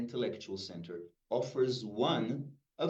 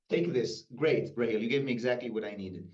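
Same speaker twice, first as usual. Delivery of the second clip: ordinary delivery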